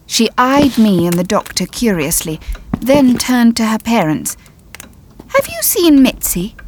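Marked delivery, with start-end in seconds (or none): 5.3-6.5 high, lightly voiced young girl; little girl voice. A narrator's reading. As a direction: ask like a small child. Little girl asks parents.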